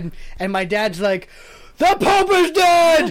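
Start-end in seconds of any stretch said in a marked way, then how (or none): 1.8-3.1 slow, high mock holler; drawn-out, hammed-up proclamation; announcing shout. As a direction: bellow the news like dad at the lodge. Dad announcing the death.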